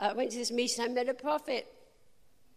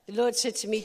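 ordinary delivery

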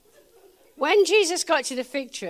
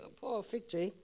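mock pity; 4 semitones lower, 13 dB quieter than usual. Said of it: "sort of think".

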